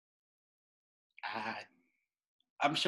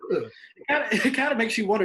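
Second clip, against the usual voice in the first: laughing, a bit higher; 7 semitones higher, 5 dB louder than usual. Real, not acted. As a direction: talk through a laugh. Laughing after nurse stories.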